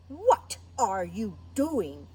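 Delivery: slow, loud lion demanding an answer; stern, drawn-out big-animal voice; indignant lion. Acted. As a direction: confront a small intruder, slowly and loudly. Lion confronts the mouse.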